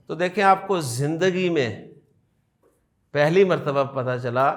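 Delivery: ordinary delivery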